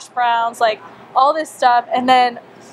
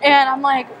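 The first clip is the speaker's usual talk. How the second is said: ordinary talk, nothing marked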